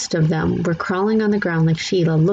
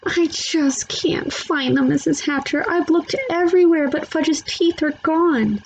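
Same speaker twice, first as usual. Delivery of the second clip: sniffling; 10 semitones higher than usual. A narrator's reading. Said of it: "Sheila sniffled and said".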